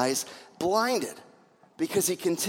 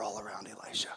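awed whisper; 12 semitones lower, 16 dB quieter, faster than usual. Acted. Climax of scripture reading.

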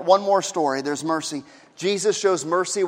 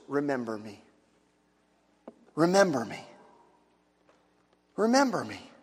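much softer, a pleading whisper of a plea; hushed plea; 5 semitones lower, 8 dB quieter than usual. Acted. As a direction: let it fall to almost nothing, begging. Dying thief's plea.

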